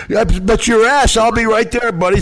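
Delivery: hot-tempered mock threat on the phone; angry retort. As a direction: bite back at the rude clerk. Angry retold phone reply.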